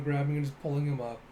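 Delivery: ordinary delivery, nothing marked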